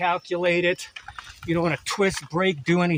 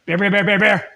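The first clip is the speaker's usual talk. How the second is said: loud calls to warn bears; scaring off a bear; 4 semitones higher, 5 dB louder than usual. Real, not acted. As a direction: shout out to scare bears away. Calling out to scare bears.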